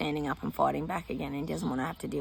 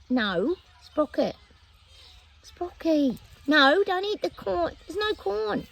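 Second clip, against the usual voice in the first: high, rising, louder no; high scolding call to the dog; 11 semitones higher, 4 dB louder, slower than usual. Real, not acted. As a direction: call the dog off the corn, getting higher. Stopping dog eating corn.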